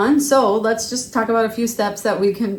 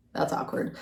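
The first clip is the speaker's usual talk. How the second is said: whispered aside; 9 dB quieter than usual. Real, not acted. Aside after friendship statistic.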